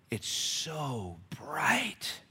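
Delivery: slow breathy whisper, drawn out on bright; awed whisper. Shown as a demonstration. Preacher echoing the boys' awe, slow whisper. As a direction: hush it in wonder. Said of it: "notice the text now".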